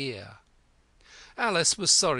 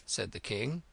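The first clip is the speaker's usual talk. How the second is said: ordinary delivery, nothing marked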